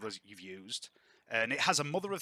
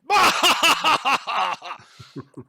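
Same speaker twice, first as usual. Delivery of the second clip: booming high belly laugh; hearty lordly laugh; 13 semitones higher, 14 dB louder than usual. Acted. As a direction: roar with laughter, trailing down. Lord laughs at his own joke.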